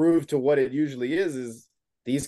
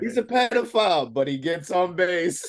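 meme voice; 5 semitones higher than usual. Retold or imitated. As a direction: quote the meme high, stretching the last phrase. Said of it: "it's like that Wander Franco meme".

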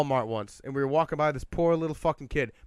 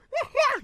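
high-pitched mimicked laugh burst; imitated laugh; 3 dB louder than usual. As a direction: copy your friend's squealing laugh. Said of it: "Craig just starts fucking laughing".

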